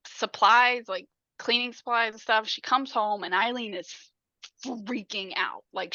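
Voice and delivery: ordinary talk, nothing marked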